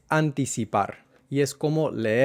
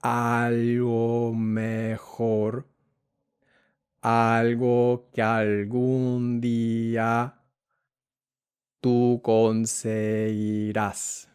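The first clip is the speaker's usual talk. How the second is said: very slow reading; much slower than usual. Shown as a demonstration. Teacher guiding the student through the verse, very slowly. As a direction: stretch each word, slowly. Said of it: "como digo, muy lento".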